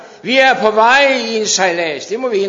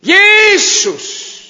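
disciples crying out; much slower than usual. Acted. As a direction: cry out to him from a sinking boat. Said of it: "disciplene står op og råbte".

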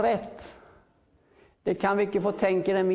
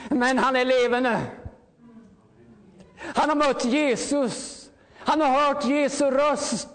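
fervent preaching; 5 semitones higher than usual. Acted. Preacher calling the congregation to come out, fervent. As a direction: preach it with rising fire to the congregation. Preacher building to full force.

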